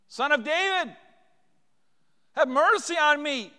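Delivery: loud, high cry for mercy; high-pitched beseeching shout. Acted. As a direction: call out desperately to be heard over a crowd. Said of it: "Those guys cried out".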